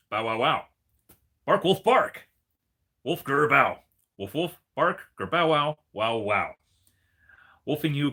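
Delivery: loud, hammed-up barks and growls read as words; barking dog-speak reading. Owner reading the card aloud in dog talk, loud and hammy.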